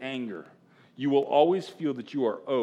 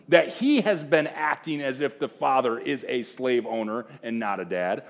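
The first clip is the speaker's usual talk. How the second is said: ordinary talk, nothing marked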